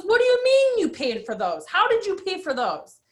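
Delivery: mock frantic husband. Husband grilling wife about the gifts, high mock panic.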